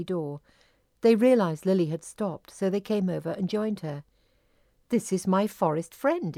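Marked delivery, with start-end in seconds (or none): none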